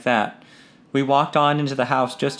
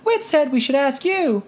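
put-on high girlish voice, stifling a giggle; half-giggling; daughter; 13 semitones higher than usual. A narrator's reading.

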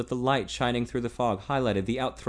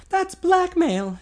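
put-on higher, slow, stunned young woman; breathed in disbelief; 7 dB louder, much slower than usual. A narrator's reading. Heroine hearing of the blackmail, breathed incredulously. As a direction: let it out in disbelief. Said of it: "she breathed incredulously".

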